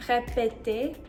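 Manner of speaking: Répéter said clearly; French pronunciation. Shown as a demonstration. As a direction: model Répéter. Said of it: "correct responses".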